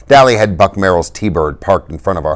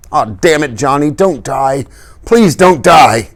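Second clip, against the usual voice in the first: higher, slow, breaking plea; desperate begging; 9 semitones higher, 4 dB louder, slower than usual. A narrator's reading. Dally at Johnny's deathbed, desperate plea. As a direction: beg him not to go. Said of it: "he begged".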